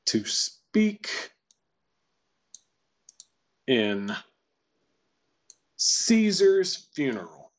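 broken into slow pieces, leaping up on "speak" and "Caesar's"; wave-like intonation. Shown as a demonstration. Teacher matching the line to a pitch diagram, wave-like intonation. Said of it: "that kind of wave that you see there".

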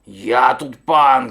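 loud, slow domineering bellow; booming lordly bear; bear shouting. A narrator's reading. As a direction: declare yourself master, drawing it out. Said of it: "кричить".

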